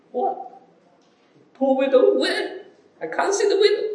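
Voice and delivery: squeaky high, baffled voice searching the crowd; puzzled onlooker. Pretend. A bystander asking the crowd where the widow is, high and baffled. Preacher acts someone missing the widow.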